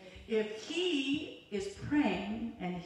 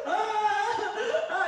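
high, loud, comic bawling; mock wailing; 11 semitones higher, 5 dB louder, much slower than usual. Preacher mimics herself crying over the Bible, hammed-up wail. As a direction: imitate a wail that breaks into laughter.

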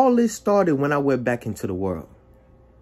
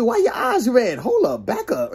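high-pitched, startled questioning; alarmed challenge; 9 semitones higher than usual. Retold or imitated. Confronting the DJ about his red eyes, alarmed. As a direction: question him while backing him off.

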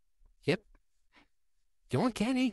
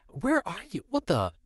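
ordinary delivery

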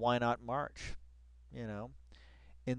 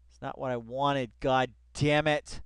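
usual speaking voice

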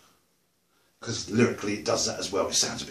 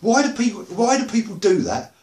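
loud, higher exasperated rant; ranting outburst; 9 semitones higher, 6 dB louder than usual. Real, not acted. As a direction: burst into the rant. Starting a rant.

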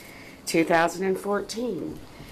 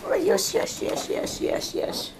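much higher sing-song coo; puppy cooing. Real, not acted. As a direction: gush over the puppies. Cooing to puppies.

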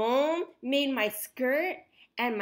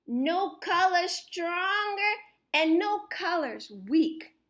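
ordinary delivery

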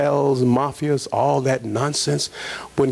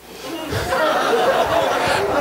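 a short laugh that climbs high; high breathy laugh; 13 semitones higher than usual. Real, not acted. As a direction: laugh at the absurd idea. Laughing at the idea.

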